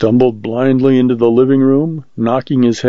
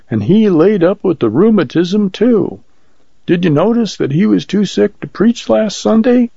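higher-pitched fretful woman's voice; high-voiced neighborhood worrier; chattering frightened housewife; 7 semitones higher than usual. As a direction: gossip anxiously, then usher the visitor in. Voices a talkative woman in the story.

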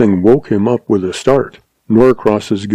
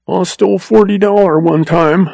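blubbering, much higher; frightened blubbering confession; 9 semitones higher than usual. A narrator's reading. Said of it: "blubbered the agent".

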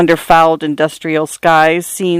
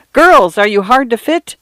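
ad announcer voice; 6 semitones higher than usual. Acted. Billboard slogan read like an ad.

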